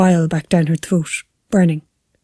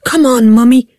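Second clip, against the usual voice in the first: put-on raised, louder child's nagging call; higher, louder chiding; playful chiding; 4 semitones higher, 5 dB louder than usual. A narrator's reading. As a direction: hurry Mummy along like an impatient child. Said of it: "chiding her".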